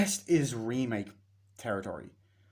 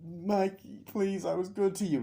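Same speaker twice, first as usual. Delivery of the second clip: higher, lightly voiced begging plea; pleading victim; 7 semitones higher than usual. Trejo begs Michael to spare him, lightly voiced plea.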